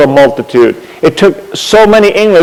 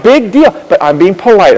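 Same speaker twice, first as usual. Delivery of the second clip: normal talking voice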